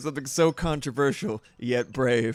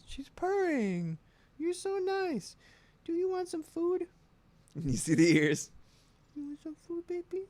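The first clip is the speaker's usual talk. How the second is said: much higher, softer cooing; 6 dB quieter than usual. Real, not acted. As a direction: coo over the purring cat. Baby talk to the cat.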